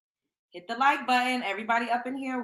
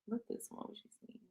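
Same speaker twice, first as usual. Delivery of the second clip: whisper; 18 dB quieter, faster than usual. Real, not acted. Muttering unvoiced aside.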